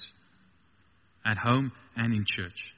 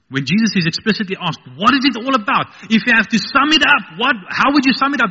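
loud, high, urgent preaching questions; full-force preaching; 11 semitones higher, 10 dB louder than usual. Real, not acted. Preacher voicing the question put to Jesus, full-force preaching. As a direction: build the questions up at high pitch.

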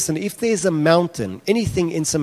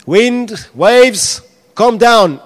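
high, loud command to the storm; commanding shout; 8 semitones higher, 8 dB louder, much slower than usual. Acted. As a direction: order the wind to stop.

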